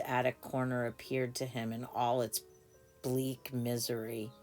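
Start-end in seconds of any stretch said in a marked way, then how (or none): none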